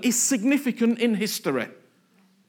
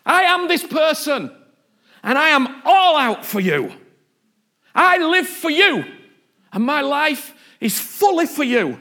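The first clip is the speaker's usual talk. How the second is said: loud, raised, fervent cry to God; David's all-out declaration; 4 semitones higher, 4 dB louder than usual. Acted. David stripped of externals crying to God, loud and fervent. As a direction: proclaim total devotion at full voice. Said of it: "getting rid of all the external stuff and going".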